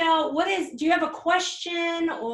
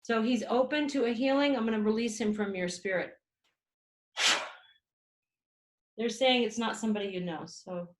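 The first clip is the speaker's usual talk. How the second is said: healing invocation; 4 dB louder than usual. Acted. Healer releasing a spirit for the client, solemn invocation. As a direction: call the light in like a healer.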